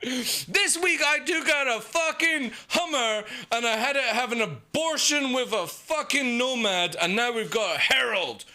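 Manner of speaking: oversell it like a paid shill; mock hyped streamer. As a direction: streamer hyping a ship to chat, mock-giddy. Mocking hyped streamer promo.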